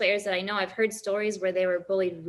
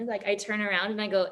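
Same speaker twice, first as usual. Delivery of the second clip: ordinary talk, nothing marked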